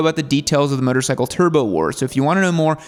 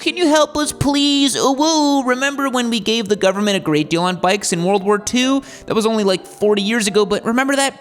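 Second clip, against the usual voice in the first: high, whiny begging voice; pleading Harley; 7 semitones higher than usual. Acted. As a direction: beg in a high wheedling voice. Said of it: "And Harley was like".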